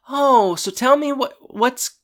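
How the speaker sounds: very high, prying imitation; mock nosy interest. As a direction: jump in high, fishing for gossip. Rachel nosily jumps in.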